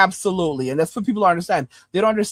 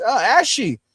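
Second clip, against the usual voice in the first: normal talking voice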